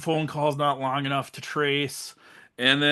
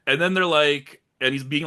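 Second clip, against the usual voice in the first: normal talking voice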